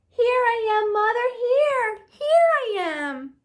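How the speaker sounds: calling up to the plane. Acted. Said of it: "he called out".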